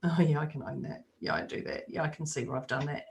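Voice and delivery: low, soft, accepting murmur; calm acceptance. Retold or imitated. Answering criticism with a low, soft shrug. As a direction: shrug it off quietly, owning it. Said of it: "I'll just go".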